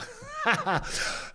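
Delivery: a sudden high-pitched laugh. Real, not acted. A vlogger laughs at his own throat story in a high giggle. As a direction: burst into a squeaky giggle.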